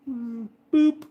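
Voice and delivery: squeaky high boop. Pretend. Booping dog's nose.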